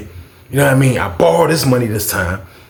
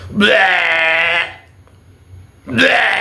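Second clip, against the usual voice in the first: retching sound rising higher; mock retching; 6 semitones higher than usual. Imitating the guy gagging, mock retching. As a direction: gag it out, pitch climbing.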